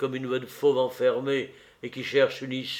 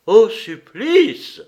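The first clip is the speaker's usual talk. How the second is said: slow, much louder and higher, stretched out; horrified cry; 10 semitones higher, 13 dB louder, much slower than usual. A narrator's reading. Nero cries out in horror at his sentence, slow loud shriek. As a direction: cry it out in disbelief, dragging the words. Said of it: "s'écria Néron".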